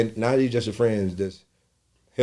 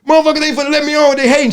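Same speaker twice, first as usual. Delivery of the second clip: much higher, louder rapid complaint; fast loud rant; 12 dB louder, faster than usual. Retold or imitated. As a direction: vent fast that they're hating. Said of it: "I said".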